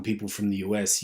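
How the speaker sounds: normal talking voice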